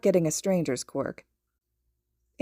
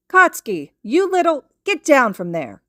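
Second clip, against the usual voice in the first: loud, much higher furious mom yell; angry scolding; 7 semitones higher, 8 dB louder than usual. A narrator's reading. Mother yells at her son to get down. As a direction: scold him at full volume.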